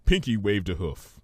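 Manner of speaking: usual speaking voice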